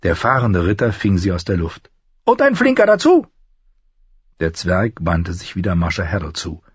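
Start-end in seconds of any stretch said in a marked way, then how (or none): 2.2-3.3 high-pitched, approving dwarf voice; praising dwarf lord. A narrator's reading. Dwarf praises the knight who caught his coin, high and approving. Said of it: "lobte Lannister".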